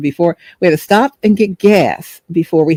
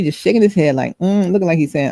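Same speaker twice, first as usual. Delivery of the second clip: ordinary delivery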